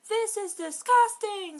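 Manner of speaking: high, loud disgusted scream; a high, loud shriek of disgust. Acted. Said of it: "screamed Hermione".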